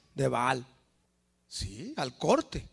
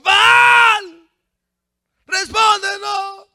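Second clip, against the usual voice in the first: very high, long wailing shout; acted frantic cry; louder desperate calling; 14 semitones higher, 13 dB louder, much slower than usual. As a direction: scream to wake a sleeping god. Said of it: "Llámele más fuerte".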